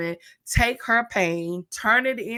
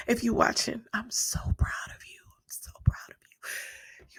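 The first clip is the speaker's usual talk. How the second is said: choked whisper, breaking with emotion; tearful whisper; 3 semitones higher, 5 dB quieter than usual. Real, not acted. Mentor whispering pride to her mentee, tearful whisper. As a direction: barely get the words out through tears. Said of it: "I ain't cut none of that out".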